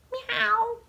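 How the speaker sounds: high, squeaky little meow; cat meow. Acted. Reading a baby book to children, high cat meow. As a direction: mew like a tiny kitten. Baby says animal sounds.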